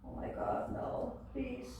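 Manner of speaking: breathless, hushed plea; frightened whisper. Retold or imitated. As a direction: beg softly in terror. Child dreading the voice.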